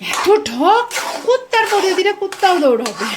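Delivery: high, loud mock scolding like an old grandmother; grandmother's saying. Quoting her grandmother's proverb to a friend, high and loud. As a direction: belt out granny's old saying. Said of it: "যে আমার ঠাকুমা বলতো".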